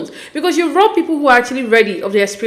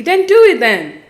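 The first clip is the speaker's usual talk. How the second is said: higher, louder, frustrated push; fed up, telling him to just act; exasperated vlogger scolding a reality show groom; 7 semitones higher, 4 dB louder than usual. Real, not acted.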